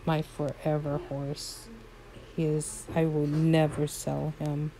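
usual speaking voice

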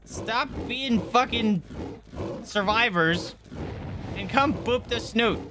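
slow, high-pitched pig plea; exasperated cartoon pig begging for attention. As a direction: coax them in a drawn-out high voice. Pig begging survivors to boop.

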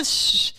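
a whispered hiss of a shush; crowd shush. Retold or imitated. Imitating the crowd shushing the comic on stage. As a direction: hush him like a bored audience. Audience hushing him.

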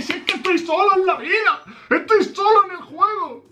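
high hammed-up plea; mock desperate wail. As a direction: wail it as if abandoned, in jest. Mock complaint of being alone.

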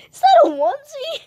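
very high, loud question; incredulous squeal. Real, not acted. Player asking Steve about his onesie, incredulous squeal. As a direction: ask it again in a loud squeak.

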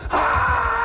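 high, shouted crowd cheer; crowd roar imitated. Arena crowd cheering the announcer, mock roar. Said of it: "they go".

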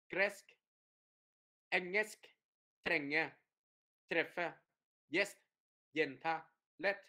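short "e" in each word, cut off quickly; đọc dứt khoát. Shown as a demonstration. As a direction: say each word quick and crisp, one at a time. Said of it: "đọc lẹ, dứt khoát". (đọc lẹ, âm "e" ngắn gọn)

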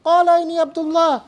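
high, fast infant recitation; baby voice. Acted. Imagining the baby wrapped in a blanket, baby voice. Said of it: "baby wrapped up in a little blanket and goes".

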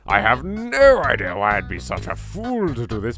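ordinary talk, nothing marked

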